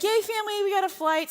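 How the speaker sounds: high, tired hooray; mock cheer. Mother announcing the Montreal route to the family, tired mock cheer. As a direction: cheer with weary irony. Finally rebooked, ironic cheer.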